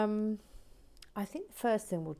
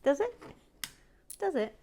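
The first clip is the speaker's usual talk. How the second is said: very high, loud teasing question; playful mock taunt, pitched way up; teasing squeak; 9 semitones higher, 3 dB louder, faster than usual. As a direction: tease him with a squeaky high voice.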